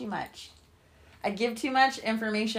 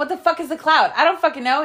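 louder, higher, fed-up outburst; loud mock frustration; 6 semitones higher, 8 dB louder than usual. Telling Sam about the cloud, exasperated yell. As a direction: throw up your hands and yell it.

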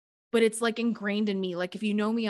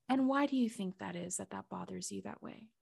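much softer, gentle questioning; soft guiding voice; 7 dB quieter than usual. Retold or imitated. Mama Gaia gently questioning her, soft and calm. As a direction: ask it softly, like a patient guide.

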